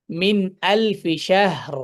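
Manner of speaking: slow মিন আলফি শাহর, vowels held; recitation. Shown as a demonstration. Teacher reciting the verse ending, slow recitation. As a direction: recite it slowly, drawing each word. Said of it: "ফায়ের উপরে মিন আলফি".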